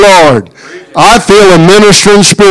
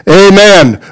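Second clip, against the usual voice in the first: ordinary talk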